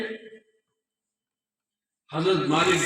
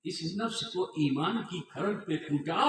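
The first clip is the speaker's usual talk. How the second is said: ordinary delivery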